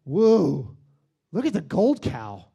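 loud awed gasp at the gold cow; amazed whoa. Retold or imitated. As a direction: gawk at the shiny idol. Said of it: "people go".

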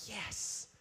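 whispered, imitated "yes" of relief; acted whisper of satisfaction; whispered relish. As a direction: hush it like a private little victory. Jonah relishing the shade.

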